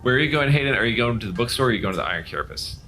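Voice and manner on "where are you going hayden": ordinary delivery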